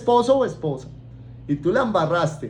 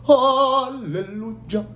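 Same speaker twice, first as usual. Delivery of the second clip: slow, drawn-out pious aleluya; mock holy; 3 semitones higher, much slower than usual. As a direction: sing out like a saint. Mock holy after repenting. (aleluya piadoso y burlón)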